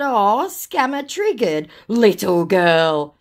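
drawn-out, slow teasing; exaggerated mock sympathy; mocking taunt. Taunts the scammer in reply.

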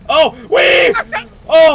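loud high yelps of thrill; excited shout. Real, not acted. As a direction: yell out at the dragon attack. Dragons attacking during the game.

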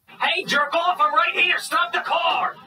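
high urgent yell; angry calling out. Acted. Man chasing the driver's car, yelling. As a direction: holler after the car while chasing it.

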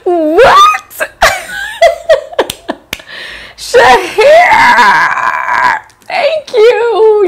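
high shrieking disbelief then thanks; overwhelmed high-pitched thanks; flattered squeal. Real, not acted. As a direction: squeal with laughter at the compliment. Viewer compliment, overwhelmed reaction.

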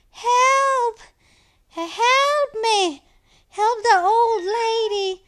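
high, drawn-out mock cry for help; playfully pitiful high wail; hammed-up plea for help. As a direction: call out slowly, playing helpless. Plays a helpless victim calling out.

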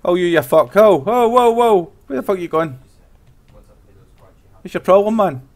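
angry. Acted. In-character street confrontation.

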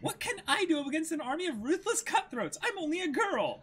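put-on girlish voice. Reading Nami's frightened line.

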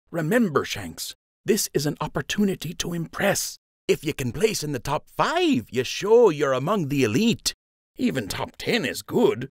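high, upbeat pep talk; encouraging friend. Acted. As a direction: cheer him on. Lund encourages Joe.

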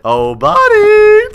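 very high, slow stretched "Oh, buddy"; mocking sing-song. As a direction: croon it like a mock pity call. Taunting a fleeing player.

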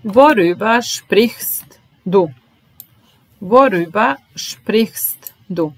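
slow and clear so learners can copy; careful slow teacher read; slow model question. Shown as a demonstration. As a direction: draw out the question for the class. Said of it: "teacher models the question slowly".

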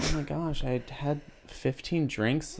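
put-on soft, slow pick-me girl bragging; lightly voiced pick-me girl. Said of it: "impression of a pick-me girl".

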